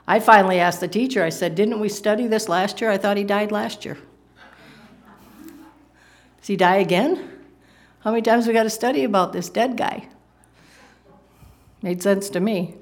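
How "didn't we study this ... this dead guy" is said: slightly higher, questioning schoolboy; a lightly voiced child's complaint; puzzled kid. Kid asking the Sunday school teacher, mildly puzzled complaint. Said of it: "When I was a kid in Sunday school".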